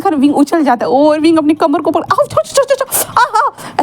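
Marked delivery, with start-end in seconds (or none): 2.0-3.8 high yelping ouch after a slap; pained yelps. Retold or imitated. Wing, slapped hard on his bum, high pained yelps. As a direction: yelp and hiss in pain, half laughing. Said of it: "ऐसे करते जा रहा था".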